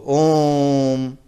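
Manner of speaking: om held very long and slow, three beats; drawn-out om. Shown as a demonstration. Teacher drawing out ओम for the class, very slow. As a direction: stretch ओम for three full beats. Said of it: "जब उसको देर तक कहते हैं".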